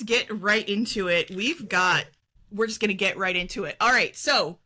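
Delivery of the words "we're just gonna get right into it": fast. Pretend. Running gag repeating phrase.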